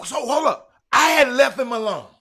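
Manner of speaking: heated outburst. Real, not acted. Host erupts over rival's remark.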